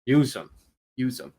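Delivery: ordinary delivery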